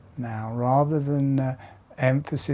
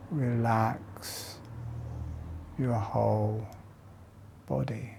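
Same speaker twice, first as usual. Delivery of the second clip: guided meditation. Acted. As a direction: lead them gently through each breath. Guides slow jaw breathing exercise.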